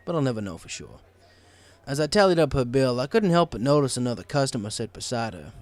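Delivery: plain reading voice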